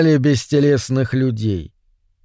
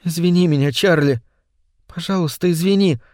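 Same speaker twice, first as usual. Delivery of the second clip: higher, tender apologetic voice; pleading wife; 4 semitones higher, faster than usual. A narrator's reading. Weeping wife holding Чарли, pleading apology. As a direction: beg forgiveness gently, close to tears. Tearful wife begs forgiveness.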